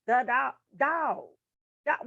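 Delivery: slow, drawn-out "dog", said on its own; single word repeated. Shown as a demonstration. Showing a bad repeat to the hard of hearing, slow isolated "dog". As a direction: repeat just "dog", stretched and emphatic. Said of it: "don't just say".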